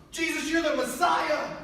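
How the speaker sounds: a bold yell proclaiming the Messiah; yelled declaration. Retold or imitated. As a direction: shout it out for the whole crowd to hear. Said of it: "Peter's a yeller".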